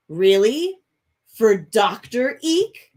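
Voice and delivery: loud, slow suspicious question. A narrator's reading. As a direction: drag out Doctor Eek with doubt. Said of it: "gazes at you suspiciously".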